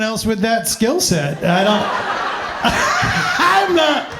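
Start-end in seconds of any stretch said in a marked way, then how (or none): 1.9-3.6 high laugh. Real, not acted. Laughing at own joke.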